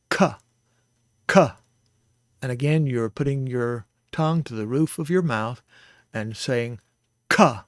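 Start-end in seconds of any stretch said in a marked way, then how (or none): 0.1-1.5 "k" duro e explosivo; hard k. Shown as a demonstration. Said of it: "hard K sound". 7.3-7.6 "k" explosivo, mais alto; plosive k. Shown as a demonstration. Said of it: "a plosive sound".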